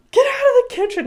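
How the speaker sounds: put-on very high, loud kid's shriek; child yelling. Mom imitating daughter yelling everyone out. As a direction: shriek at the whole family. Repeated tantrum yell.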